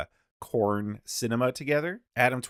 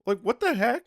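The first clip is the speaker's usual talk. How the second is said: incredulous; 13 semitones higher, 4 dB louder than usual. Real, not acted. Incredulous at debate opponents.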